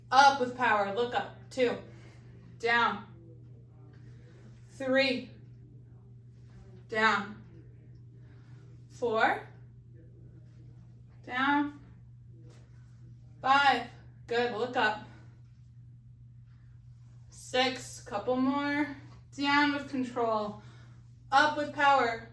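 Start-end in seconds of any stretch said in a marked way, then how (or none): 0.1-0.9 louder, punchy coaching call; loud energetic fitness cue; powerful cue. Shown as a demonstration. 19.4-20.6 drawn out, steady coaching cue; slow calm fitness cue; slow and controlled. Shown as a demonstration.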